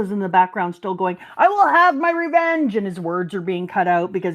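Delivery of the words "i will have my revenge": villain vow. Retold or imitated. Wapol ranting in the background.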